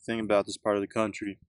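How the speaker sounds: casual Southern American accent. Real, not acted. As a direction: slip into a Southern accent, half by accident. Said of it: "I just said that in a southern accent".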